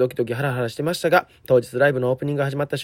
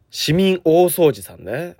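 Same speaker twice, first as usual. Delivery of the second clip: ordinary talk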